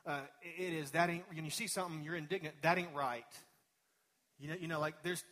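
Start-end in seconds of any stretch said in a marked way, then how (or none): 0.9-1.2 indignant local saying. Retold or imitated. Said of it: "the way we say it around here". 2.6-3.3 indignant local saying. Retold or imitated. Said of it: "the way we say it around here".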